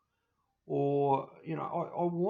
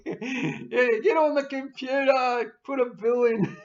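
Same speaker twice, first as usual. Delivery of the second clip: higher, louder, laughing through the order; laughing greedy adviser; 8 semitones higher, 8 dB louder, slower than usual. Retold or imitated. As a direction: cackle as you bark for another bill.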